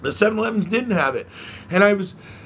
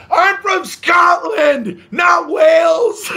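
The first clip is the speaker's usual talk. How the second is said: slow, high, indignant shout; 12 semitones higher, 7 dB louder, much slower than usual. Retold or imitated. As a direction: bellow the correction slowly. Paul corrects the host loudly.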